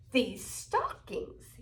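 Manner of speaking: the Grinch gloating over the stockings, softly; grinning Grinch. A narrator's reading. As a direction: savour it with a sly grin. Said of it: "he grinned".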